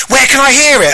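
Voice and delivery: high, eager posh question; eager posh man. Retold or imitated. Posh man wanting to hear the show, high eager posh voice. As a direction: imitate a keen posh man. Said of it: "he is actually posh".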